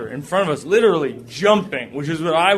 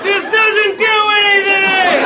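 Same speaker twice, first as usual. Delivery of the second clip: frustrated yell; slower than usual. Pretend. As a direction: yell at a useless parachute. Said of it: "I'm like".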